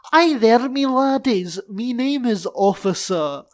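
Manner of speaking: high, loud Scottish greeting; very Scottish accent. Acted. Police officer introducing himself, very Scottish accent. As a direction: greet them in broad Scottish. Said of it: "in a very Scottish accent".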